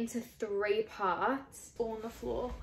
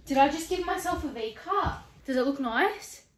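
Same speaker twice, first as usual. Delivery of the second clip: higher and louder, giddy; excited questions; 4 semitones higher, 8 dB louder than usual. Real, not acted. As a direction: ask eagerly, barely containing glee. Excited reveal asking mom.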